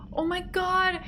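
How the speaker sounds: high gasp then a high oh my God; a sudden delighted idea; excited gasp. Real, not acted. Streamer struck by a bakery name idea, gasping high.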